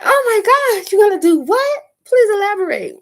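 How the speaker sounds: high, loud excited squeal; thrilled squeal. Retold or imitated. Urging the man to go on, excited squeal. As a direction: squeal it with giddy delight. Excited reaction to his plans.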